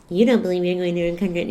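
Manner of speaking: fast, incredulous mock repeat; mocking disbelief. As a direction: throw the advice back in disbelief, quickly. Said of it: "my reaction to that was".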